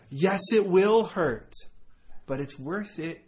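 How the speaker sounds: ordinary talk, nothing marked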